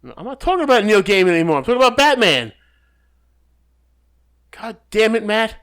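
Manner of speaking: loud, high exasperated rant, rushing. Real, not acted. Cohost loses patience with Matt over Batman, loud and exasperated. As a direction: shout him down in frustration.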